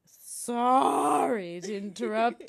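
slow, drawn-out sarcastic sorry; huffy fake apology; mock apology. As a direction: apologize with exaggerated, stretched words. Mock apology to busy partner.

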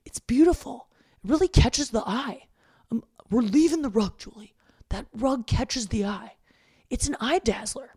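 mock dad. Voicing dad defending rug.

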